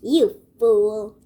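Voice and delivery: wife cries out in contempt; angry cry. Acted. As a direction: snap it at her husband. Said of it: "his wife cried".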